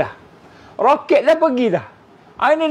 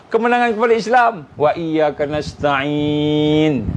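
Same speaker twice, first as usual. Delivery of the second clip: fervent prayer; 4 semitones lower than usual. Acted. Fighter praying alone the night before battle, low earnest prayer. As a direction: pour out the heart to Allah, low and unhurried.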